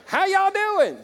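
loud Southern greeting. Pretend. Pastor hams up the Southern greeting for the crowd, laughing in a Southern drawl. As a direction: belt a hammed-up Southern howdy, laughing. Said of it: "They don't get that in Estonia".

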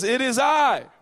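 high, loud announcement; shouting Jesus. Acted. Jesus identifies himself to frightened disciples, shouting. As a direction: holler it out to the boat. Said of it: "And he says".

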